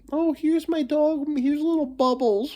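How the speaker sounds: high cutesy pet voice; mock doting owner. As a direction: coo at the dog like a cutesy owner. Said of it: "why do we have to call dogs".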